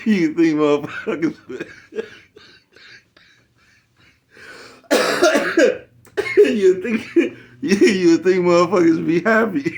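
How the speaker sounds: high, loud words through real laughter; can barely get it out for laughing; real laughter breaking the words, high and loud; laughing hard. Real, not acted. Cracking up mid-sentence.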